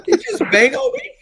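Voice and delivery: laughing through question; giggling disbelief. Real, not acted. Laughing while asking.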